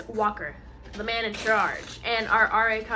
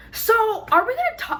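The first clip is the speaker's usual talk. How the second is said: confronting friend; 10 semitones higher, 5 dB louder than usual. Retold or imitated. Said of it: "Schaefer goes to Kennedy".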